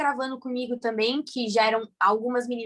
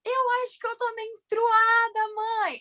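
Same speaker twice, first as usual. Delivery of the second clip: high, drawn-out call to mother; acted-out cry of alarm, stretched slow; retold yell for mom; 9 semitones higher, much slower than usual. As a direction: call out to wake your mother, pitched way up. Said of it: "Aí eu grito pra minha mãe".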